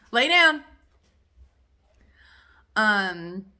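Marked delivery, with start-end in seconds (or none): 0.1-0.6 loud, high, laughing order to the dog; laughing command. Real, not acted. Host laughs and orders her dog to lie down.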